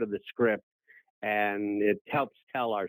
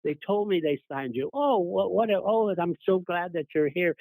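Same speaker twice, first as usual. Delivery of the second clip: much higher, gushing woman's voice; delighted Donna Reed; 8 semitones higher than usual. Retold or imitated. Actress welcoming her former screen son, higher and gushing. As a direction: gush warmly, pitched well up. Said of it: "She said".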